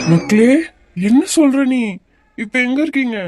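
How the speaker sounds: high, loud, shocked questions; a father hearing his wife collapsed, shocked and loud; alarmed father. Acted. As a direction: jump up in alarm. Father alarmed by daughter's news.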